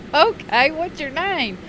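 high bright question; chirpy Sheila. Retold or imitated. Sheila asking the engineer again, high and chirpy. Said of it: "and she said".

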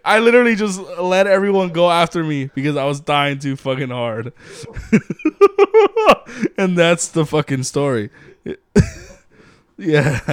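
ordinary talk

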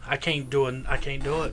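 ordinary talk

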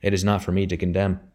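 ordinary delivery

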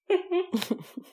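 short high burst of laughter. Real, not acted. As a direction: laugh with delight. Listener laughs at the joke.

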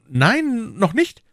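hohe, helle Mädchenstimme; junge Frau. A narrator's reading. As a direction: Lizzie als junge Frau sprechen. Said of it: "erwiderte Lizzie". (hohe Stimme einer jungen Frau)

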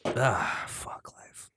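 whispered resignation. Real, not acted. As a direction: breathe it out under the breath. Before chugging beer.

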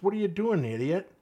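high, loud, exasperated jab; mock exasperation. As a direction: scold him like a foolish buddy. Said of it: "looks at him and goes".

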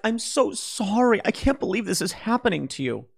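hammed-up pity, stretching so sorry; mock sympathy. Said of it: "I wouldn't be like".

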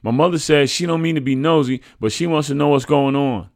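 put-on high, loud boy relaying a message; child messenger. A narrator's reading. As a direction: recite mother's message in a high voice. Boy passes on mother's message.

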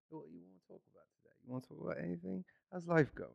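soft, put-on British small talk; British accent. Shown as a demonstration. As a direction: slip quietly into a British accent. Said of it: "it's like a mask that you can put on".

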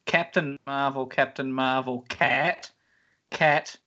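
usual speaking voice

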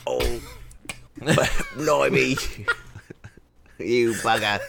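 loud laughing Cockney "blimey"; laughing Cockney swearing; Cockney accent. Acted. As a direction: ham up a Cockney curse through laughter. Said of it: "your British Cockney voice".

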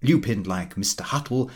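plain reading voice

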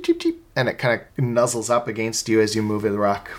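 ordinary delivery

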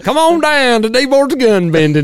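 high, loud pitchman voice; TV ad announcer. Pretend. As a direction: sell it like a local TV commercial. Mock ad for gun bending.

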